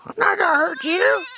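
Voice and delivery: high squeaky soothing voice. Real, not acted. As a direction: coo it to calm them. Soothing scared goat.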